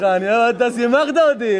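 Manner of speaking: ordinary talk, nothing marked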